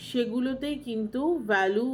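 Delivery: ordinary talk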